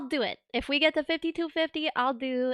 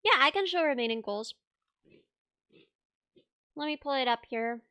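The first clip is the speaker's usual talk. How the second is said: talking through a bite; chewing while talking; 3 dB quieter than usual. Real, not acted. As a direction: mouth full. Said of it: "Sorry, I'm chewing".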